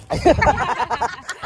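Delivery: high burst of laughter; bright laugh. Real, not acted. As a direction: laugh at your own mistake. Laughing at own mispronunciation. (tawang-tawa sa sarili)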